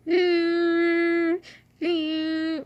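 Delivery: high, sustained droning hum; vacuum noise. Shown as a demonstration. Owner imitating the vacuum her dog loved, as a steady high hum. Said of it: "I'm trying to do vacuum noise".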